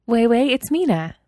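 thrilled girl on a pretend call; enthusiastic greeting. A narrator's reading. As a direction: greet her like the best news ever. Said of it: "Mina greeted her enthusiastically".

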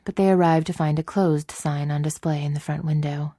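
ordinary delivery

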